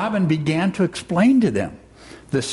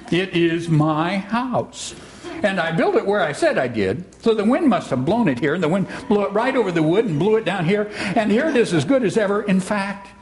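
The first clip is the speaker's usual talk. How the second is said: Eeyore marvelling; 5 semitones higher than usual. Acted. Plays Eeyore finding his house.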